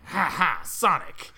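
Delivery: slow, loud cartoon villain laugh; Eggman voice. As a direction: gloat like a mad scientist. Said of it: "more like".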